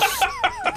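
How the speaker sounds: very high squealing laugh; cracking up in a squeal; shrieking laugh. Real, not acted. Friends joking about a movie goon, squealing laugh.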